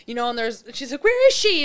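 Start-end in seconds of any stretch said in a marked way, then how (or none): 1.1-1.6 high, loud mock panic; frantic question. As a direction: demand it in an acted panic.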